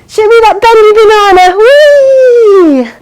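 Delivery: high, loud playful squeal; excited. Pretend. Teacher showing kids the belly banana, gleeful. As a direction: make it a giddy game.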